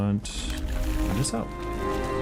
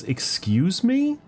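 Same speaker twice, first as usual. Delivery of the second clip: incredulous; 11 semitones higher, 3 dB louder, slower than usual. Real, not acted. Shocked at opponent's hand.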